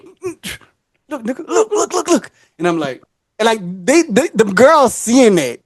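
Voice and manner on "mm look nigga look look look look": 1 urgent nudging whisper. Retold or imitated. Tim tapping, urging him to look.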